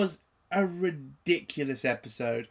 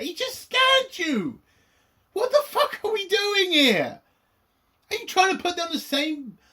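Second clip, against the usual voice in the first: much higher, incredulous mock outburst; exasperated rant; 13 semitones higher than usual. Reviewer ranting back at Bella over the scan, exasperated high outburst. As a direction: rant at her like she's lost it. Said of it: "I was like".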